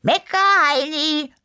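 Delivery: high, loud, drawn-out retort; calling back. A narrator's reading. Music lover answers the complaining neighbour, shouting back. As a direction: yell the insult back across the courtyard. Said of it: "kam es zurück".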